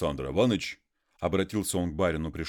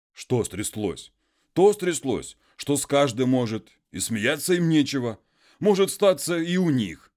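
higher, louder indignant muzhik; worked-up peasant; 9 semitones higher, 7 dB louder than usual. Acted. Konstantin complains of his wife to the master, raised and indignant. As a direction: rail against the wife, raised and loud.

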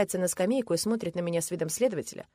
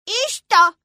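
put-on high, loud childish challenge; defiant little girl; 14 dB louder than usual. A narrator's reading. Girl dismissing father's objection, defiant. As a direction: throw it back at him.

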